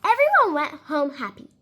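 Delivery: plain reading voice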